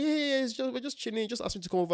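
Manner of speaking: high-pitched, hurried mock reassurance; brushing it off. Reassuring a suspicious partner about a visit, mock dismissive. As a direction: imitate someone airily waving away suspicion.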